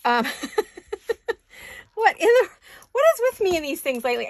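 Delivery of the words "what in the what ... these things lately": high startled laugh into fast words; surprised laughing. Real, not acted.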